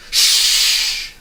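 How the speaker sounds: loud hissed shush; man hushing Milo in alarm; sharp shush. A narrator's reading. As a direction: hush him urgently. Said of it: "he cautioned".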